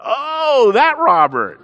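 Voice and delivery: high, loud "oh" of realisation; a pastor suddenly recognising the man who spat at him; sudden recognition. Retold or imitated. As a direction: it dawns on you all at once.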